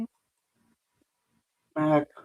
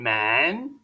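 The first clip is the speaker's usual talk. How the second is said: deep mock man voice; 11 semitones lower, 4 dB quieter than usual. Streamer mocking a first lady as manly, deep mock voice. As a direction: drop way lower for a booming man.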